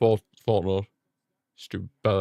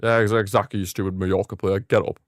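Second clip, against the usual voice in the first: ordinary talk, nothing marked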